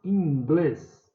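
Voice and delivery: "Inglês" drawn out, weight on GLÊS; teacher's demo of "Inglês", final syllable stressed; stressed last syllable. Shown as a demonstration. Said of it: "é o glês".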